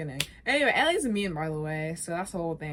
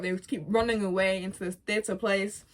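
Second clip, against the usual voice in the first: usual speaking voice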